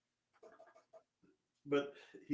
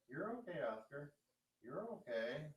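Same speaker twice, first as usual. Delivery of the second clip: normal talking voice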